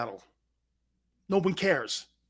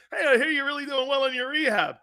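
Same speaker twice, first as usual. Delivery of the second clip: caller bit; 7 semitones higher than usual. Retold or imitated. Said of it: "Dude, the bit sucked".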